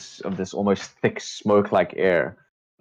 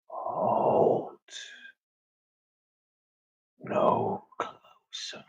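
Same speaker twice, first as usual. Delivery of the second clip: slow, hushed warning from a mist creature; eerie creature whisper; 6 dB quieter, much slower than usual. Acted. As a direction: whisper it slowly, drawing out each word. Creature's voice warns the approaching players.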